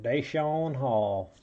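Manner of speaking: slow, stretched-out southern drawl; mock southern accent. Card opener repeating a player's name for fun, hammed-up southern accent. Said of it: "saying that with a southern accent".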